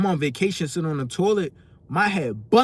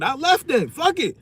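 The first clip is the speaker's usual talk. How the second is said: defiant shout; 10 semitones higher, 4 dB louder than usual. Retold or imitated. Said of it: "I'm like".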